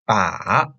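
"ba" dipping low then rising; third tone ba. Shown as a demonstration. Teacher showing that third-tone ba means target. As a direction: dip "ba" down and back up.

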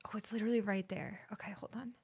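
soft whisper, hiding; tense whisper. Real, not acted. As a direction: whisper so the monster won't hear. Hiding from monster quietly.

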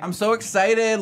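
high, loud excited squeal. Real, not acted. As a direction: burst out with excitement. Opening hype for episode.

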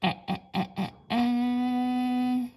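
short "eh" repeated, the last one held long; sounding out letters. Shown as a demonstration. Teacher sounding out red, repeated short e sound. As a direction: bounce "eh, eh" then stretch it. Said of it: "learning about letters and sounds".

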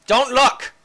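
high, loud mock order; mock command. Player ordering viewers not to peek, mock command. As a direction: shout a playful warning.

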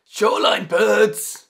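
slow, loud Scottish shout; Scottish yell. Acted. Navigator Angus spotting birds, loud slow Scottish yell. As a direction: bellow it like a Scottish lookout. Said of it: "yelling in his Scottish accent".

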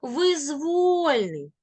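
Визвольний with long, high stressed воль; stress on second syllable. Shown as a demonstration. Teacher demonstrating correct stress on the root. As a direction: lean hard on воль in Визвольний. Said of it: "від слова воля".

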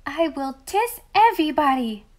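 grand little lisp. A narrator's reading. Little princess granting kisses to all the boys, grandly lisping. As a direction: bestow it grandly like a tiny queen. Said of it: "with reckless condescension".

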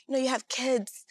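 normal talking voice

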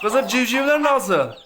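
high, loud, slow call. Real, not acted. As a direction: call out to the hen, drawn out. Calling to the mother hen.

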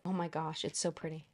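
real quiet awe at the packaging; awed hush. Real, not acted. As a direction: murmur it like you can't believe it. Awed murmur at the box.

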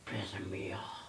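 whispered grumble; venting under your breath; annoyed muttering. Real, not acted. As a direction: drop to an irritated whisper. Real argument, muttering after outburst.

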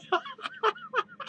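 high breathy-free giggle, soft. Real, not acted. Laughs after coughing fit.

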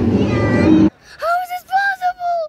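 anguished cry. Acted. Dramatic dinosaur disaster play.

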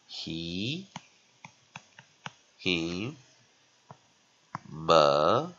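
"He" and "him" separated by long pauses, drawn out; syllable demonstration. Shown as a demonstration. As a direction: sound out "him" and "么" one syllable at a time. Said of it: "所以怎样".

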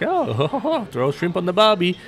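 high-pitched teasing Australian impression; mock Australian accent. Locals teasing an Australian about where he's from, mock Australian accent. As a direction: put on a hammed-up, high Aussie accent. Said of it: "mate, Australian".

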